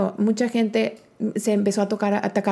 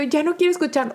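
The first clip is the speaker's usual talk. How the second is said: whiny protest; 7 semitones higher than usual. Retold or imitated. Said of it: "Y yo".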